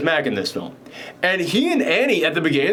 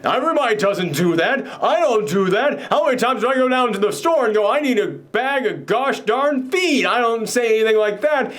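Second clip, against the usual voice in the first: higher, rising, offended protest; indignant outburst; 8 semitones higher than usual. Retold or imitated. Annie scolding the writer about the swearing in his book, high and indignant. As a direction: huff like a prim woman scandalized by swearing. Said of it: "she starts, like, losing her mind".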